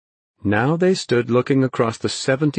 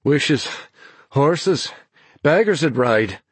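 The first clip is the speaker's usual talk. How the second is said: breathless, broken-up banter; panting; 5 semitones higher, slower than usual. A narrator's reading. Weary Ben teases Naomi back, panting. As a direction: pant out one word at a time, raised pitch. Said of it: "he panted back".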